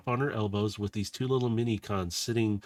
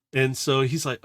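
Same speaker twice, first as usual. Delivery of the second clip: ordinary talk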